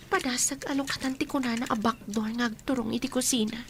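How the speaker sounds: normal talking voice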